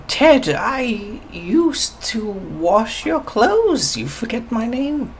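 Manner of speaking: put-on high, slow old woman's voice; reproachful old lady, high pitched. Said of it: "she looks at you and she goes".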